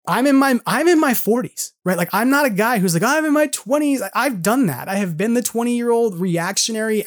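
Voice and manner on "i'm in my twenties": higher, mock-boastful young fan; mock young guy. Forties host mocking his younger self, mock boastful. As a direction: lightly parody an eager twenty-something.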